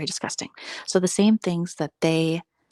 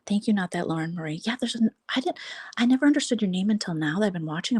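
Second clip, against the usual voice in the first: ordinary delivery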